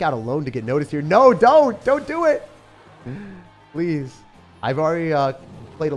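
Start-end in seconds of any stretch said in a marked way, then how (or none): none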